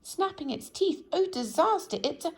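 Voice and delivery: panicked shrieking. A narrator's reading. Mister Jelly imagines a crocodile in the bushes, shrieking high. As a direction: spiral into wilder fears. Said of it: "he shrieked".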